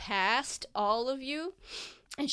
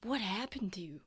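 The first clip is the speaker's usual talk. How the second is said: whispered concern; 5 dB quieter than usual. Acted. Mother sees injured son.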